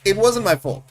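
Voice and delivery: mock excuse. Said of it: "and I say".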